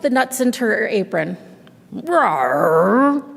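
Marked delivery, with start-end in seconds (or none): 1.9-3.2 hammed-up roar, a bit louder; mock bear roar. Bear roars over losing at dreidel, hammed up. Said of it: "Old Bear roared".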